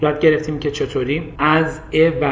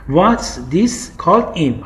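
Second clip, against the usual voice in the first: "what's this called in" stretched out word by word; very slow; much slower than usual. Shown as a demonstration. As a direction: drag out each word for learners. ("what's this called in" را خیلی کشیده و آهسته بگو)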